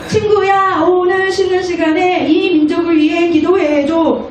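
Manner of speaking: put-on high, slow kid's call to a classmate; child calling friend. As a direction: call out like an eager schoolkid. Child asks classmate to pray.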